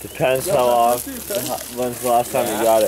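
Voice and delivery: loud. Real, not acted. Talking over the noise.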